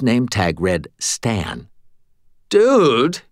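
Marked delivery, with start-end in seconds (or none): 2.5-3.2 startled shout. A narrator's reading. Cashier startled by glass.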